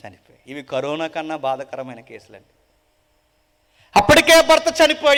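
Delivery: ordinary talk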